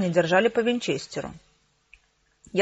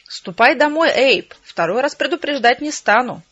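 higher, louder order; stern warning; 4 semitones higher, 5 dB louder than usual. A narrator's reading. Sheriff ordering Abel to go home, loud and firm.

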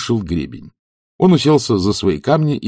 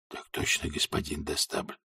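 whispering; 6 semitones lower, 16 dB quieter than usual. A narrator's reading. Whispered talk at stage edge.

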